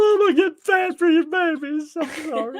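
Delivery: put-on very high, loud crying kid voice; high hammed-up sobbing kid; mock child wail. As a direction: wail like a begging toddler, laughing. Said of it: "You're like".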